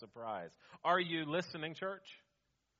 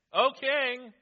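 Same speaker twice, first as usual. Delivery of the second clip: high whiny flatterer; 10 semitones higher, 11 dB louder than usual. Acted. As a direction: whine it up at the king. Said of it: "This is kind of a whiny, nasally, high-pitched voice".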